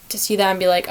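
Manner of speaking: ordinary delivery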